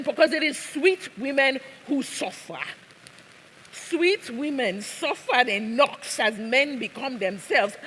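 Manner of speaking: put-on high-pitched, impassioned accented protest; mild acted yell. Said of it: "why are you yelling".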